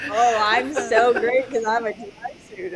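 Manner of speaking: hammed-up mock boasting; bragging. Teasing a friend about the dry suit, mock bragging. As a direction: brag like a self-satisfied diver, stretching SO. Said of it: "brag about being like".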